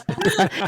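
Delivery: quick bright laugh; amused laugh. Real, not acted. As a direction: laugh at the new name. Laughing at name change.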